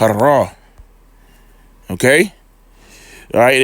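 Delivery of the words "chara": Chara with a tongue-rolled R. Real, not acted. Teacher reading out a Hebrew word, "Chara" with rolled R. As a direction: roll the R in the middle of "Chara". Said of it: "I roll my tongue like that".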